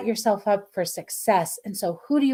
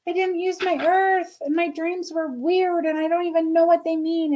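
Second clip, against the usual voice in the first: high, put-on whiny morning voice; a hint of a grumpy wake-up voice; mock groggy self; 8 semitones higher than usual. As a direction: lightly act out waking up confused. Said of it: "I'm like".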